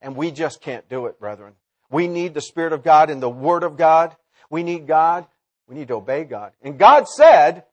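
ordinary talk

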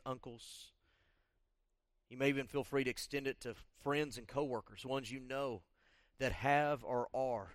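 soft, subdued prayer-leading voice; guided congregational prayer. Acted. Pastor guiding the church to confess sins, soft prayer leading. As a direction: quietly lead them in prayer, with long pauses.

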